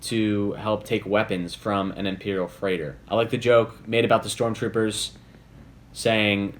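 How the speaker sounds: ordinary delivery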